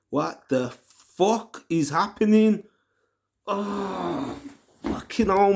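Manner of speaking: ordinary talk, nothing marked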